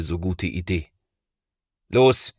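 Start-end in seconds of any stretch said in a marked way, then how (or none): 1.9-2.3 a sharp, louder order like a father; energetic command. A narrator's reading. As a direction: snap him into action. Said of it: "sagt Thomas energisch". (energisch, bestimmt wie Papa)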